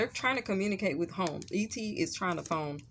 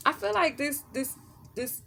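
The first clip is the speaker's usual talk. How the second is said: ordinary talk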